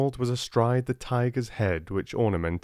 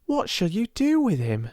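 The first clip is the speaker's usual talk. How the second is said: put-on eager servant girl asking; high curious maid; Becky's voice; 10 semitones higher than usual.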